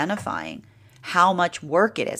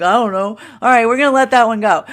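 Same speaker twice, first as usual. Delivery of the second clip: higher and louder, shrugging it off with a laugh; amused dismissal; 6 semitones higher, 11 dB louder than usual. Retold or imitated. Waving off fear's strange idea, amused and loud. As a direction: breezily let the odd idea go. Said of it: "Be like".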